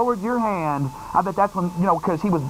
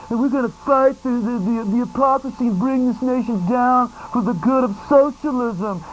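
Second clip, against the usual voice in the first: a touch higher parody of a leftist orator; hammed-up campus radical; lightly voiced mock activist; mock socialist speaker; 3 semitones higher than usual. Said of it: "We want somebody up there going".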